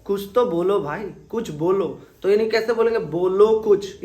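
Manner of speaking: usual speaking voice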